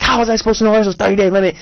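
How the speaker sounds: high, fast, exasperated outburst; indignant rant at the phone rep; livid. Retold or imitated. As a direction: fire it off, outraged and disbelieving. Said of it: "this time I am livid".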